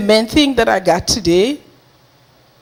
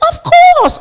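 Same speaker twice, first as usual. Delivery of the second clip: high, loud burst of willingness; imitated eager agreement; 8 dB louder than usual. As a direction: exclaim it like a delighted helper. Helper eagerly agrees in retold story.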